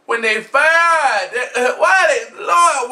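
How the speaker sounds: high, loud cry to the Lord; hammed-up crying out; outraged lament. Real, not acted. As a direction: wail in mock despair.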